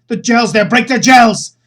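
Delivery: loud, high urgent calling; urgent shout. Acted. Sergei yells at her about the gels, loud and urgent. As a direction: shout the order across the room. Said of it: "And he goes".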